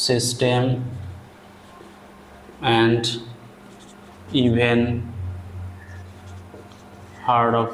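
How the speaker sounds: slow, word-by-word dictation with long pauses. Acted. Teacher dictating a paragraph to students, slow and deliberate. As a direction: read each word out clearly so students can write it down.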